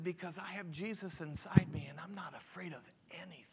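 whispered, calm and sure; hushed calm reply. Retold or imitated. Calm passenger whispering to panicked neighbour. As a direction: breathe it out softly, unafraid. Peaceful passenger answers calmly.

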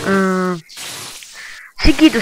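ordinary talk, nothing marked

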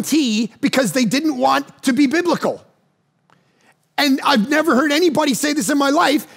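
high-pitched, amazed rush of a stunned friend; breathless disbelief, voiced friend; excited retelling. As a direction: blurt it out like you can't believe it. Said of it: "He goes".

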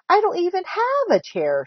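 high-pitched indignant cry; high, upset protest; child's shout. A narrator's reading. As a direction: shout it like a wronged little kid. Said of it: "shouted little Chan".

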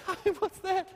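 high, laughing jab at the tiny screen; laughing disbelief. Real, not acted. Speaker mocking the conference screen, laughing. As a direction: laugh it out, incredulous.

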